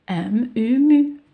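"M, U" rising into a loud, high "mu"; teacher blending "M, U" into one held "mu"; syllable sounding-out. Shown as a demonstration. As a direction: climb through the letters and land on "mu". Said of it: "ونقرأ في نفس الوقت".

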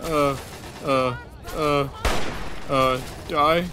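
high, loud, panicky bursts; panicked pleading. Real, not acted. Player begging a game enemy to die, panicked. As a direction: plead loudly mid-fight, pitched well up.

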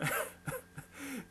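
high, breath-driven laughing fit; burst of laughter. A narrator's reading. Whole table amused by the mother's slip, bursting out laughing. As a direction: laugh helplessly without words. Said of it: "éclata d'un rire général".

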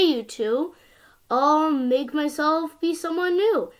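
ordinary delivery

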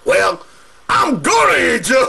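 high, greedy troll voice; begging, whining troll who wants the goat; hungry troll. Acted. Troll begging to eat the goat, high and greedy.

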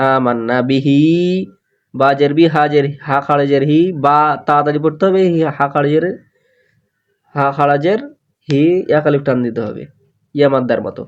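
usual speaking voice

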